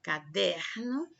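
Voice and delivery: caderno, slow with weight on der; slow demonstration of caderno, DER held; stress on middle syllable. Shown as a demonstration. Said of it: "der é a sílaba mais forte".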